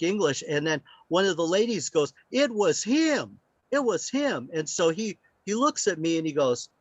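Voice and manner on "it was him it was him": excited insistence. Retold or imitated. Excited lady pointing him out.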